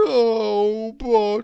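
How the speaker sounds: slow, loud, drawn-out moan; long melancholy character wail; mournful, melancholy. A narrator's reading. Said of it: "came the mournful reply".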